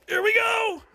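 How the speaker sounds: hammed-up cry as the ride drops; mock ride yell. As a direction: call out in comic alarm.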